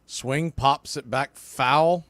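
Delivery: ordinary talk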